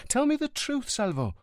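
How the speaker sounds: put-on high, anxious girlfriend pressing him; suddenly agitated. A narrator's reading. Livia suspects Montalbano is in danger, agitated. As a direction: wake up and press him, worried.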